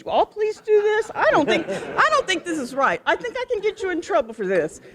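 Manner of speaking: high-pitched, disbelieving objection; retelling her own scandalized objection, voice jumping high; incredulous protest. Retold or imitated. Retold protest to officer at stop.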